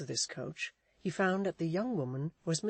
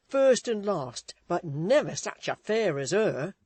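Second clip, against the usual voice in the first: loud, gruff exasperated cabman; despairing coachman; 4 semitones higher, 7 dB louder than usual. A narrator's reading. Coachman complaining to Walter about his fare, loud exasperation. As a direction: grumble it out loud like a fed-up driver.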